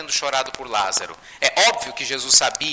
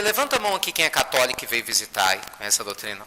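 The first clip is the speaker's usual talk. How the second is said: normal talking voice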